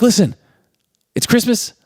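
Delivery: high, emphatic retort in short bursts; mock defiance. Worker telling her manager she's going anyway, acted high and insistent. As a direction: act out standing up to a boss. Mother defies her boss.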